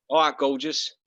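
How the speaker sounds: cockney geezer greeting; thick East London accent, matey; Danny Dyer accent. Acted. Guest shows the host how, Danny Dyer cockney accent. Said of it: "Do it in a Danny Dyer accent".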